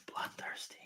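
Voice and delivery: hushed, voiceless title reveal; whispered emphasis. Shown as a demonstration. Host redoing the episode title as his co-host asked, whispered. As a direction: drop to a dramatic whisper for the title. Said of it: "to emphasize the title".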